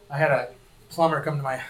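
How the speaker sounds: ordinary delivery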